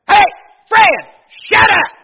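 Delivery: loud high shout out the window; daddy yelling at dogs. Retold or imitated. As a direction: yell at the barking dog. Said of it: "He'd say".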